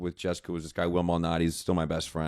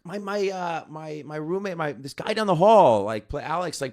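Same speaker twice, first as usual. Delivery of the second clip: high-pitched, badly faked Wisconsin accent; halting, high Wisconsin impression; 9 semitones higher, 5 dB louder than usual. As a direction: imitate a Wisconsin friend, pitched well up. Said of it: "trying to do a Wisconsin accent".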